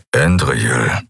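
a low gruff rumble; growled name, deep and short. A narrator's reading. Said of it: "brummte er".

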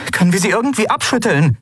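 fast, high, pressing question; urging. Acted. Endriel urges Aylan to shake them off, pressing. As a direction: press for an answer, quick and high. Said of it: "drängte sie Aylan".

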